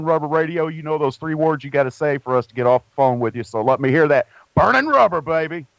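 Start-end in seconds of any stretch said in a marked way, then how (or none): 4.5-5.6 punchy catchphrase. Shown as a demonstration. Host cues the driver with the show's sign-off, punchy. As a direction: belt out the show's slogan.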